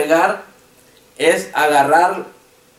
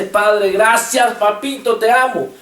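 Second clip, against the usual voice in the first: mock joyful cry; 4 semitones higher, slower than usual. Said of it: "que grita y le dice".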